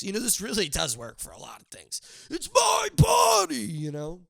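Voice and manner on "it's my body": high, slow shrieking yell of "it's my body"; mock scream. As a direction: screech it like a hammed-up tantrum. Mock excuse for anything.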